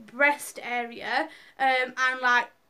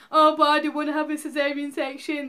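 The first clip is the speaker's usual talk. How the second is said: retold crying plea; 3 semitones higher, 5 dB louder than usual.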